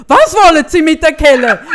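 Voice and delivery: loud appalled question; as if the idea is scandalous, loud; horrified. Retold or imitated. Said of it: "So wirklich entsetzt".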